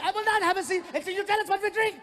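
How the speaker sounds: put-on fast high German accent shout; German accent yelling. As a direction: yell it like an angry German.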